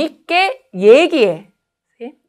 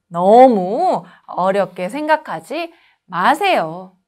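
ordinary talk

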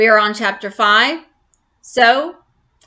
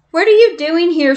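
higher, louder, fast demand; 5 semitones higher, 5 dB louder than usual. Acted. Maya finds the girls hiding in her trailer, demanding fast and loud. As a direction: fire the question at them, fast and sharp. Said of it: "she demanded".

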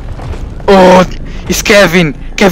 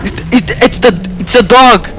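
startled; 8 semitones higher than usual. Real, not acted. Monster appears in game.

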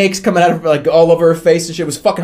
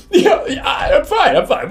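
flustered reassurance; 10 semitones higher, slower than usual. Retold or imitated. Flustered cover-up after nightmare.

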